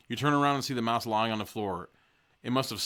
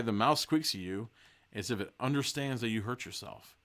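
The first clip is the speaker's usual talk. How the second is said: ordinary talk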